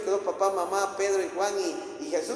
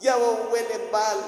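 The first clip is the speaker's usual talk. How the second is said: raised, high lamenting wail, acted; Marta's crying out; 6 semitones higher than usual. Marta to Jesus about her dead brother, acted wailing cry. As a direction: reproach him through an imitated lament. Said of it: "y sale Marta gritando".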